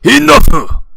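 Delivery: put-on huge drunken roar; explode, furious; yelling. A narrator's reading. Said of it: "Shoji yells".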